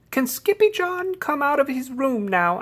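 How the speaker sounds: high-pitched pleading kitten voice; little sister. A narrator's reading. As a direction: ask Mama in a small high voice. Little sister asks Mama.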